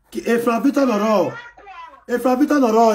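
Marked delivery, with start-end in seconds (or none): 2.1-2.9 loud, high name call. Real, not acted. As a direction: call the name across the room. Calling out fan name.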